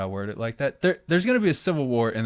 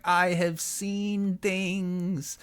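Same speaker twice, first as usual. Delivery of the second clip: very slow, much higher, eerie whisper-thin voice; creepy voice; 7 semitones higher, much slower than usual. Acted. Son tells his father of visions, creepy and slow. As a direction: drag each word out like a haunted seer. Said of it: "Why are you so fucking creepy".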